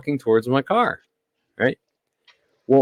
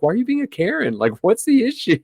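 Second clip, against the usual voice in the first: mocking question; 11 semitones higher, faster than usual. Retold argument with Karen.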